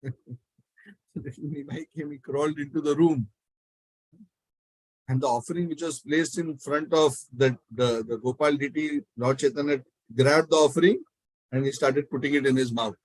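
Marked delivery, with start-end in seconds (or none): none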